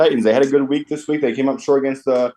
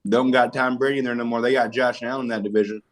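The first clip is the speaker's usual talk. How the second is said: ordinary delivery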